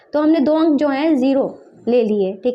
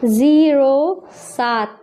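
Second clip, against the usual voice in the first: very drawn-out, separate words; slow dictation; 3 dB louder, much slower than usual. Shown as a demonstration. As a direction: stretch both words as if writing them. Dictating while writing in words.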